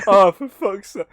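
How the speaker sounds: high, slow words through real laughter; helpless amused exasperation; laughing. Real, not acted. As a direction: sigh it out while cracking up. Cracking up at the quoted line.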